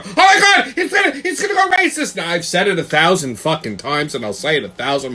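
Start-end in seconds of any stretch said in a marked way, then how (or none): 0.0-2.2 high mocking voice of offended people; mocking whiny people. Ranting vlogger mocking critics, high whiny voice. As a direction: whine it like the easily offended. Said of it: "people go".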